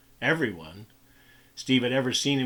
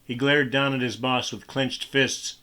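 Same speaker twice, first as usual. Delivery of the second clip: ordinary delivery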